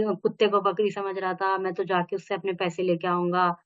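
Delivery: normal talking voice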